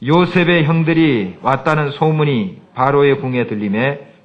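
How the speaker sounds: loud, slow congregational reading; scripture reading. Acted. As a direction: read it out together, slow and strong. Reads scripture aloud.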